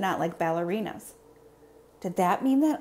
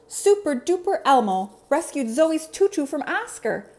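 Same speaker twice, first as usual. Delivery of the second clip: high, loud superhero announcement; Elmo calling out; 7 semitones higher, 6 dB louder than usual. Acted. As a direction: proudly call out the rescue. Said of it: "he called as he ran".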